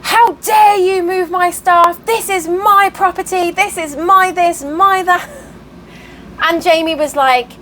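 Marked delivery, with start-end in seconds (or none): 0.0-2.0 slow, high indignant scolding; mock ballistic outrage. Woman finds her feed moved, mock ballistic outrage. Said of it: "she went ballistic at them". 2.1-3.5 high, indignant imitation of a rant; mock ballistic outrage. Woman ranting at neighbours in the barn, mock outrage. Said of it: "she went ballistic at them". 3.5-5.3 slow, high indignant scolding; mock ballistic outrage. Woman finds her feed moved, mock ballistic outrage. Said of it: "she went ballistic at them".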